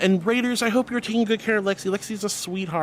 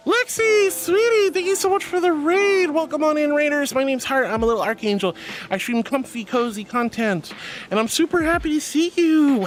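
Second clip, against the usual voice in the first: excited raid welcome; 6 semitones higher than usual. Real, not acted. Streamer welcomes a raid, high and bubbly. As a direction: throw open the doors for the raiders.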